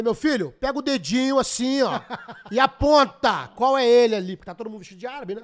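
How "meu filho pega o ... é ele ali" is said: raised, laughing command to point; loud retold order. Teller retells telling the guard to point, loud laughing order. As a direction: bark at the guard to point him out. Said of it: "Eu falei".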